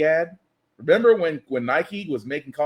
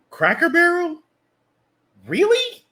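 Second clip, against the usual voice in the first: mock disbelief; 12 semitones higher, 3 dB louder than usual. Real, not acted. Disbelief at the sign change.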